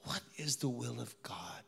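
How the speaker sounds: breathy whisper, searching; whispered question. Real, not acted. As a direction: ask it under your breath. Inner question in ICU.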